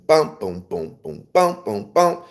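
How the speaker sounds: scat the stride bass line with punchy syllables; vocal stride bass. Shown as a demonstration. Teacher vocalizing a stride piano bass for students, bouncy scat. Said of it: "they would play, um".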